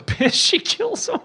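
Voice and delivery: breaking up laughing, pitch shooting up; helpless laugh squeezing the words high; can't keep a straight face. Real, not acted. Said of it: "I'm sorry, I'm laughing".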